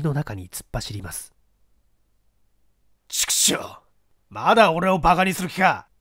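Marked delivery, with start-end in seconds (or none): 3.1-5.9 angry outburst. Acted.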